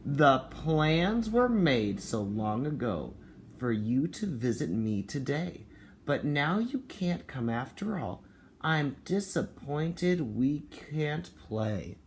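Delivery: mild disappointed read-aloud; reading to children. Acted. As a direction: read it slowly to little kids. Reading the picture book aloud.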